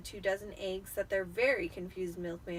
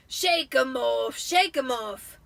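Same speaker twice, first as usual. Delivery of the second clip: slow, louder frantic repeat; Vernon muttering; 3 semitones higher, 9 dB louder, slower than usual. A narrator's reading. Vernon driving wildly, chanting slow and loud. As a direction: grumble it to himself while driving. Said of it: "he would mutter".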